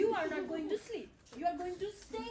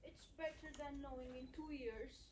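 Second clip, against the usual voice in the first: much softer, rueful murmurs; quiet sad resignation; 7 semitones lower, 12 dB quieter than usual. Acted. Couple after the quarrel, quiet and rueful. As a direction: let the fight drain out of you.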